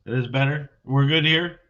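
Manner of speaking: echoing like a cave; boomy, echoing mic sound. Real, not acted. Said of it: "Now I sound like I'm in a cave".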